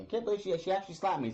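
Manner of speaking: mock disbelief. Villain mocks being slapped.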